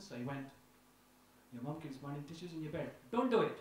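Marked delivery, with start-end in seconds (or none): 1.5-3.6 put-on Indian accent. Father in car warning his son, light Indian accent. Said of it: "so he had an Indian accent".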